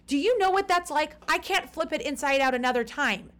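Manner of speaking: unload every grievance at full volume; screaming rant. Retold or imitated. Shouting at the airline agent in the hotel lobby, high and loud. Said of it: "I realize I'm screaming".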